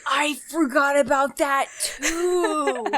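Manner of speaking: gasp, then slow, drawn-out dismay; real shock at remembering, stretched out; gasping surprise. Real, not acted. Suddenly remembering a game.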